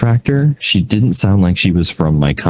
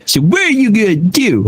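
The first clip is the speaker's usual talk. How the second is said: high-pitched mock challenge; grumpy customer; 14 semitones higher than usual. Customer demanding help from agent, mock grumpy. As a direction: imitate an indignant grouch demanding action. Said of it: "he sounded so grumpy".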